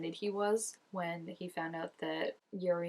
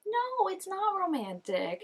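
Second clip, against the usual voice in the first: indignant; 12 semitones higher, slower than usual. Real, not acted.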